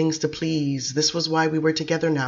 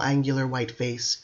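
ordinary delivery, nothing marked

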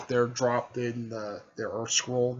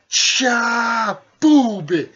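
higher and louder cartoon impact sounds; mock punch noise; 10 semitones higher, 11 dB louder, much slower than usual. Sakura smashes the puppet, loud mock impact sounds.

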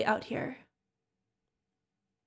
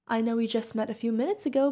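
ordinary talk, nothing marked

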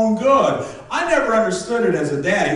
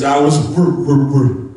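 loud stuck on wrong; can't get the word out; stammering Fonz; 6 semitones lower, 5 dB louder than usual. Retold or imitated. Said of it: "And he says".

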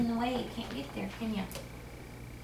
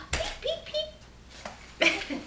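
high whispered chick peeps; breathy little chick chirps; peeping baby chick; 5 dB quieter, much slower than usual. Pretend.

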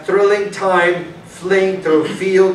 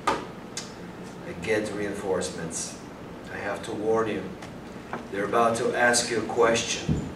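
low warning; 9 semitones lower, 11 dB quieter than usual. Acted. Schmidt quietly warning Darger about the police. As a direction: warn him under your breath.

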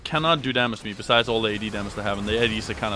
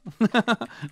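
excited laugh; 11 semitones higher than usual. Real, not acted. After the free kill.

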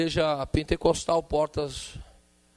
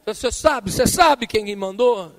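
challenging; 6 semitones higher, 7 dB louder than usual. Retold or imitated. Challenging the doorman.

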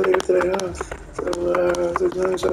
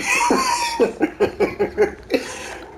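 long high-pitched laugh. Real, not acted. Streamer laughs at his own story, high and long. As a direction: let a real laugh roll out.

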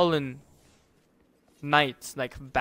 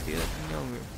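normal talking voice

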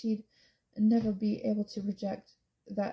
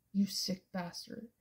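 steely and cold; 5 dB quieter, slower than usual. A narrator's reading. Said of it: "his voice like steel".

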